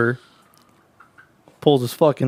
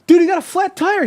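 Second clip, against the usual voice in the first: much higher, louder mock alarm; fake alarm; 6 dB louder than usual. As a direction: sell the fake warning to distract him. Tricking rival at race start.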